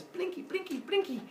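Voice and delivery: tiny high, soft voice; little squeaky sound-effect words; cutesy blinking. Acted. Narrator miming little eyes blinking, high soft squeak.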